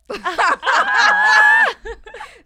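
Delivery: loud, high-pitched burst of laughter. Real, not acted. Friend cracking up at a silly show idea, loud high laughter. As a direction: shriek with laughter.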